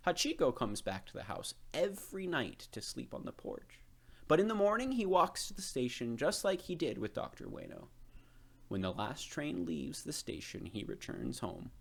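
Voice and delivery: put-on a kindly old gardener introducing himself. A narrator's reading. Old gardener explains Hachiko.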